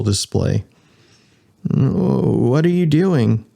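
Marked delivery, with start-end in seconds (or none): 1.7-3.4 slow question. Acted. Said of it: "Jason asked".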